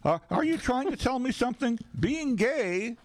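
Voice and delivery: high mock girlfriend voice. Girlfriend role texts.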